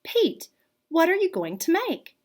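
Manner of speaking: high, bright questioning teacher voice; cheerful teacher. Acted. Teacher asking Pete what he'll make, lifted voice. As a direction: ask kindly like a teacher. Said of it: "the teacher asked".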